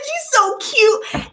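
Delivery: high, slow, cooing praise; slow high baby-talk compliment. Retold or imitated. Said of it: "I said".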